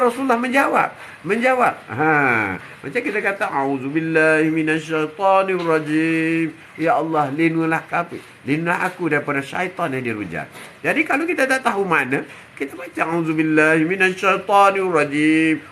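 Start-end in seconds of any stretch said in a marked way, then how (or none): 3.5-6.6 slow, savoured chanted recitation; melodious recitation. Shown as a demonstration. Preacher showing reading without understanding, savoured chant. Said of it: "Oh, sedapnya baca". 13.1-15.6 slow, savoured chanted recitation; melodious recitation. Shown as a demonstration. Preacher showing reading without understanding, savoured chant. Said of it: "Oh, sedapnya baca".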